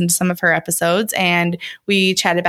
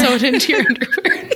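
laughing; 6 semitones higher, faster than usual. Real, not acted. Repeating a joke while laughing.

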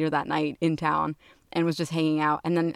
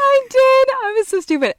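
embarrassed outburst; 8 dB louder than usual. Real, not acted. Laughing at her own mistake.

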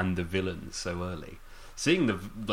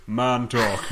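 mock Bond; 3 semitones higher, 6 dB louder than usual. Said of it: "And he goes".

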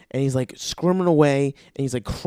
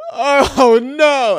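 high, loud mock wail; hammed-up bawled protest; crying child; 11 semitones higher, 9 dB louder than usual. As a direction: imitate a kid bursting into tears. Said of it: "he just immediately starts crying".

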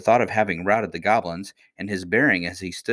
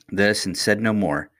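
plain reading voice

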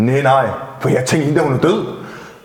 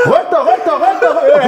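high-pitched, repeated mock yell; retold urgent yelling; 13 semitones higher, 4 dB louder than usual. Driver in his story yelling at a pedestrian, frantic mock yell. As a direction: act out yelling someone out of the way.

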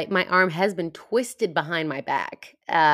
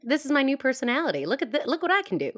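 high, fast mock bragging; mock show-off; 8 semitones higher, faster than usual. Joking about the only accent they can do, mock show-off. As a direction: show off a silly new personality, pitched up. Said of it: "I'm like".

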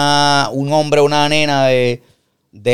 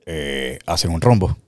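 low, slow, fumbling answer; nervous husband; 10 semitones lower, 5 dB quieter, slower than usual. Pretend. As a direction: stall nervously with a laugh. Guillermo sweating answering.

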